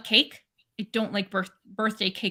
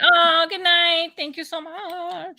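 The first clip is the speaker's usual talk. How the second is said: dog voice; 7 semitones higher, 5 dB louder, much slower than usual. Pretend. Voicing dog heading to bed.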